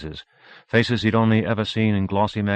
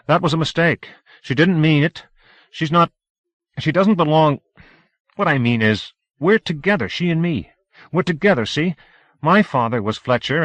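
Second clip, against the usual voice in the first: higher, louder nervous rush; flustered pleading; 9 semitones higher, 6 dB louder than usual. Acted. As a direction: talk fast to calm the crowd. Howie panics explaining to crowd.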